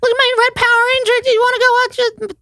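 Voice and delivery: put-on very high, rushed child voice; excited little kid. Imitating himself as an annoying kid showing off toys, very high. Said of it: "Just, like, annoying".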